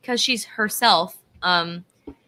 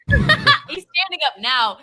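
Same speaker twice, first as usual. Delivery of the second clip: laughing; 9 semitones higher, 4 dB louder than usual. Real, not acted. Laughing at excited cohost.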